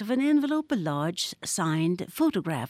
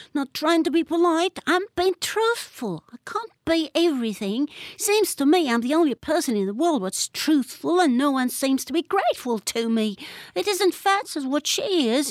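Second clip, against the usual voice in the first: a hint of an indignant schoolboy; indignant boy; 8 semitones higher than usual. A narrator's reading. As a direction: stubbornly defend himself. William voiced.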